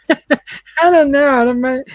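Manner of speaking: loud laughing words, slow. Real, not acted. Laughing about romance novel.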